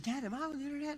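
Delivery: high-pitched little daughter voice; innocent young girl. Retold or imitated. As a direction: imitate a child innocently asking dad. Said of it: "the innocence of a young girl".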